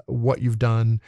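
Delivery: ordinary delivery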